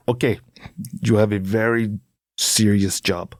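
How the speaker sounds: halting, stern bad Afghan accent. Afghan driver assigning a serious job, bad thick accent. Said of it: "I'm gonna do a bad accent".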